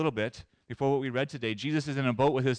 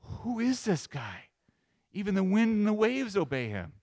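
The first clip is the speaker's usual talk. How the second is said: higher, stretched-out wonder; awed amazement; 6 semitones higher than usual. Retold or imitated. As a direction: imitate astonished disciples. Disciples amazed, voiced by preacher.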